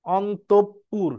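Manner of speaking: অন্তঃপুর very slowly split as on, top, pur; syllable-by-syllable pronunciation. Shown as a demonstration. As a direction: double the p in অন্তঃপুর, pausing between syllables. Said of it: "উচ্চারণ লেখো".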